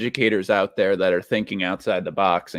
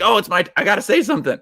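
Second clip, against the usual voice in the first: eager blurt; 10 semitones higher, faster than usual. Retold or imitated. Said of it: "be like".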